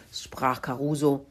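usual speaking voice

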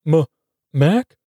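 stammered name, rising in pitch; falter, then ask the name anxiously; uncertain murmur. A narrator's reading. Davy after the gurgling from the trunk, uncertain murmur. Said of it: "Frog-Eyed Davy murmurs".

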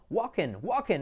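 "walking" as WAH-ken; pronunciación correcta. Shown as a demonstration. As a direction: silent l, short e in "walking". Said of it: "la I como una E". ("walking" como «guaken»)

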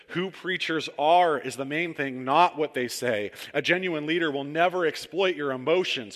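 fervent sermon delivery; preaching. Acted. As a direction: drive the warning home from the pulpit. Reading Peterson's paraphrase with fervour.

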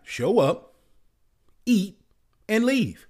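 indignant list building to a shout; exasperated outburst. Real, not acted. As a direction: spell it out in growing disbelief. Angry rant about women.

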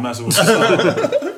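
laughing it off, loud. Real, not acted. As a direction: laugh through the words. Laughs off own joke.

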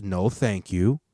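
ordinary delivery

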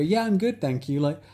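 high, bright-eyed friendly chatter; mock the cheery small-talk voice; imitating a sociable person; chirpy small talk. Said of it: "I don't normally go".

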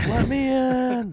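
a nervous little man begging at the door; Rick Moranis pleading. Retold or imitated. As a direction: whimper to be let in, drawn out. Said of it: "Like, like Rick Moranis in Ghostbusters".

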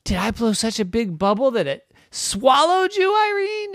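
high, rising amazement; astonished mother. Acted. Mommy learning her bubble swallowed Irene, astonished.

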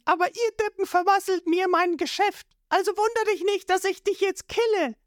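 high, cool menacing voice; threatening Nami. A narrator's reading. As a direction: calmly promise to finish him.

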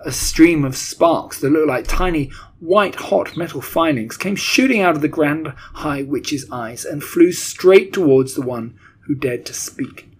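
usual speaking voice

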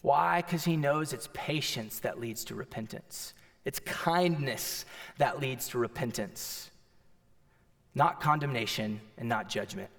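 low, soft and slow sermon voice; tender hush. Acted. Pastor gently explaining grace to listeners, hushed and tender. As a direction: bring it right down and let it land. Preacher softens to tender point.

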